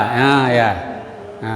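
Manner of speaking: ordinary talk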